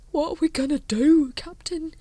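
worried. Acted. Frightened Colon.

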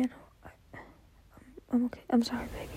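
ordinary talk, nothing marked

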